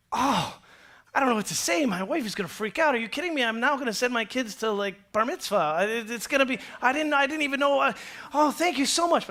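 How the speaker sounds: acted giddy thanks, tumbling over the words; overwhelmed gratitude. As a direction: gush as if you can't believe your luck. Overjoyed tenant hears debt is halved.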